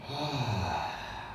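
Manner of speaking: long, low sighing exhale; slow, soft open-mouthed sigh; audible out-breath. Shown as a demonstration. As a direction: breathe out softly with a little sound. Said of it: "making a little bit of sound on the out breath".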